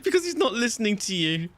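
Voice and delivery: high voice squeezed by laughter; helpless laughter. Real, not acted. Retelling the seat argument to Sav, helplessly laughing. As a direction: struggle to get the story out between laughs.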